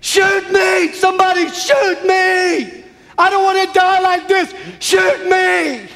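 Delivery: high desperate yelling, pleading for death; anguished shouted plea; retold screaming plea. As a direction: scream it out like a dying man begging. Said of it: "He was screaming".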